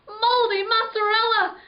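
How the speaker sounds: high panicked cry; terrified exclamation. A narrator's reading. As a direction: cry it out in terror. Trapped in coffin, terrified.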